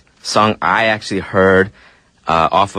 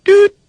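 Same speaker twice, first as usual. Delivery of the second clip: imitated tumbleweed sound; 6 dB louder than usual. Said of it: "I was trying to do, like, tumbleweeds".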